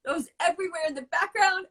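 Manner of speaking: usual speaking voice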